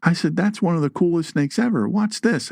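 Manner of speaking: calm them down, thrilled by the snake; excited reassurance. Retold or imitated. Snake lover telling scared neighbours to watch, excited and high.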